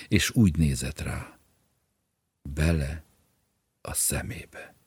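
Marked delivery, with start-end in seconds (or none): none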